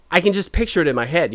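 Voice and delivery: usual speaking voice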